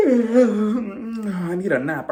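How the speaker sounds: very slow, drowsy mock voice; sleepy Charisse asking to stop filming, in a slow drowsy voice. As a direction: drag it out like you're half asleep. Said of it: "Sleepy Charisse".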